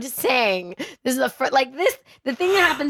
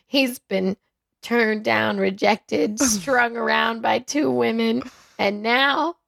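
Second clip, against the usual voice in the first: ordinary talk, nothing marked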